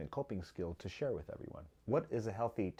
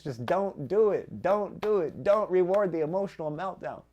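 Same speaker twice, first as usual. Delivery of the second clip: higher and louder, rising to a pitch; impassioned rant; 8 semitones higher, 7 dB louder than usual. Real, not acted. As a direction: vent it all out, getting carried away. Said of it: "I had to get that out of my system".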